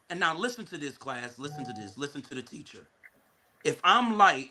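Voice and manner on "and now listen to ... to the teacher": low, very soft instruction to the class; hushed aside. Real, not acted. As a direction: drop right down and tell the class to listen.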